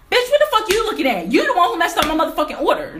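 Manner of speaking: furious yell. Retold or imitated. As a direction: snap at her across the counter, fast and enraged. Yelling at smirking cashier.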